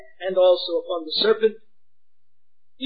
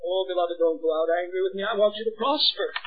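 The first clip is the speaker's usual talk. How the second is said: ordinary talk, nothing marked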